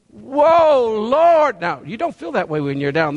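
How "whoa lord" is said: loud, delighted Whoa Lord; awed exclamation. Retold or imitated. Believer on the mountaintop, marvelling at God, exclaims loudly. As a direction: cry out in amazement on the mountaintop. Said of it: "You feel".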